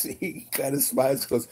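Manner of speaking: usual speaking voice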